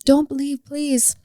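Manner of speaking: gently imploring; retold pleading. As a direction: plead with parents to stay. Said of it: "I was, like, telling them".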